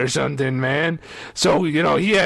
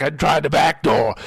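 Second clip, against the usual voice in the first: ordinary talk, nothing marked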